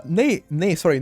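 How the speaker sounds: normal talking voice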